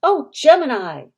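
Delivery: high, loud yelp; sudden loud, high outcry; screamed exclamation. A narrator's reading. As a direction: cry out as if just bitten. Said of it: "so that he screamed out".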